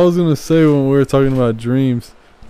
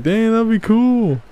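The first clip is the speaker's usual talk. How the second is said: excited; 10 semitones higher, slower than usual. Retold or imitated. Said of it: "I was gonna be like".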